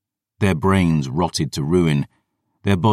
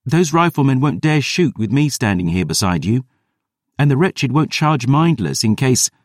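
put-on higher, eager young girl arguing; girl arguing with Gabriel, higher and insistent; insisting; 8 semitones higher than usual. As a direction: press the point, a hint of a defiant girl. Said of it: "Dior insisted".

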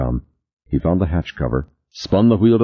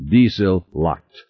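ordinary delivery, nothing marked

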